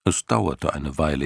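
plain reading voice

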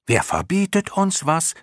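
very high-pitched cry; chorus of moths calling. A narrator's reading. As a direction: call it out like a chorus of clown moths. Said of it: "riefen alle Clownmotten im Chor". (hoch im Chor rufend)

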